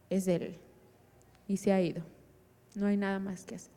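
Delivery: low, soft, halting finality; quiet resignation. Retold or imitated. Brother reports death beside body.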